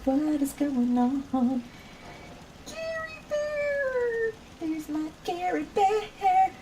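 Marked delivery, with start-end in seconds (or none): 2.7-6.5 stretched, sing-song Gary Bear greeting. Real, not acted. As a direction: coo the name up high like to a pet. Greeting a pet or friend.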